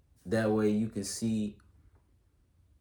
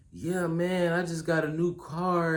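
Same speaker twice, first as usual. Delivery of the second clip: high-pitched proud announcement; excited sharing; 8 semitones higher than usual. Acted. Telling a friend about a new car, excited. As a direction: share the good news in a high, eager voice. Acting someone sharing good news.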